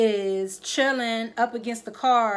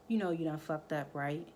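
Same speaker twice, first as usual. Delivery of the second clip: much softer, low threat; low warning; 5 semitones lower, 10 dB quieter than usual. Retold or imitated. Tate to Ghost after being choked, quiet warning. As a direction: say it quietly, like a promise of payback.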